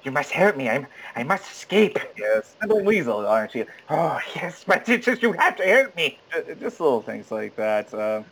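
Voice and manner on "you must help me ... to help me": weaselly pleader impression; put-on film voice, begging and squirming; Casablanca side character. Weasel begging Rick for help to escape, hammed-up pleading. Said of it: "Or the other characters".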